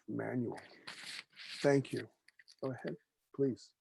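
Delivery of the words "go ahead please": normal talking voice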